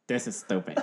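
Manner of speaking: put-on voice of a dismissive wife; wife imitation. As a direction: playfully imitate an unimpressed wife. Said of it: "my wife was just like".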